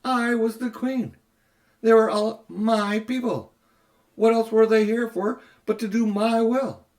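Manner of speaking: proud ruler looking down on everyone; haughty queen. Acted. Jadis to Diggory, a high imperious queen voice.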